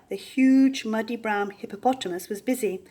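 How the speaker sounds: ordinary delivery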